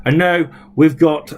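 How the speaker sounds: ordinary talk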